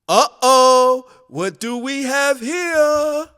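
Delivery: high, stretched-out singsong; drawn-out teasing call. Acted. A bystander heard in the background, high drawn-out teasing call. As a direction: let it ring out high and slow.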